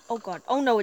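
ordinary delivery

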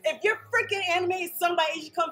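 fast, high, hyped praise; excited. Real, not acted. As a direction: rave about it fast. Hyped about the band.

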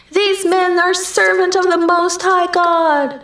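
put-on high, sustained slave girl's cry; slave girl ringing out. A narrator's reading. Slave girl following Paul and Silas down the street, ringing out. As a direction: ring it out high on one level note. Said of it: "the slave girl's voice rang out".

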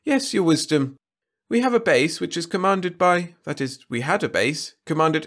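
high, anxious courtier voice; Wirt's whiny voice. A narrator's reading. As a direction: plead nervously with the king. Wirt voiced.

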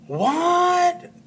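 high, drawn-out what; incredulous student. Acted. Teacher voicing students doubting the second pattern, incredulous. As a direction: stretch what way up in mock disbelief. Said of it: "this is where you say".

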